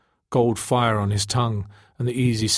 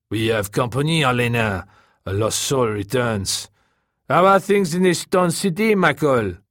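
Taka's character voice; 3 semitones higher, 4 dB louder than usual. A narrator's reading. Taka speaks in the story.

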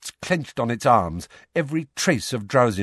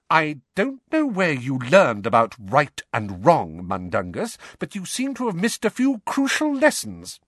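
high-pitched, cold reproof; 7 semitones higher than usual. A narrator's reading. Mother reproving the rogue on right and wrong, coldly. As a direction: scold coldly, pitch climbing. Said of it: "said Missus Weasley coldly".

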